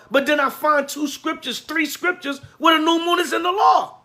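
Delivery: builds to a loud shout, pitch climbing high; preacher's rising shout. Real, not acted. Rising rebuke to critics.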